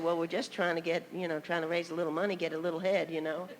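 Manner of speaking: subdued, lightly voiced disappointment; mildly deflated. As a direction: quote her quietly, as if let down. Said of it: "I noticed her countenance was changing".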